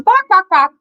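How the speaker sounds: high loud mock clucking barks; chicken noises. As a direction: imitate the hen calling her friends. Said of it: "She goes".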